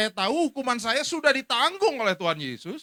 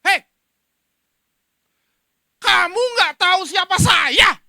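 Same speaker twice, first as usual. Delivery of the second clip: furious soldier yelling, higher and louder; angry shout; 11 semitones higher, 14 dB louder than usual. Retold or imitated. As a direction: bellow it at the man who hit him. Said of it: "dalam marahnya bilang".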